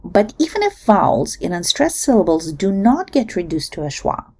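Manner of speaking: usual speaking voice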